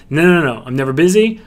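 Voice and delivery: ordinary talk, nothing marked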